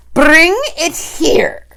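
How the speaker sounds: high, loud, slow witch shout; Grand High Witch shouting for the boy; shouted command. A narrator's reading. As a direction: demand it brought over. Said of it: "shouted the Grand High Witch".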